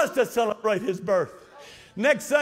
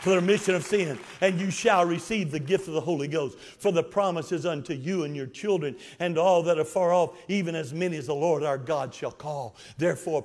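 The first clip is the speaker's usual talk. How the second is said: normal talking voice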